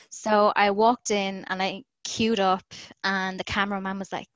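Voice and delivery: normal talking voice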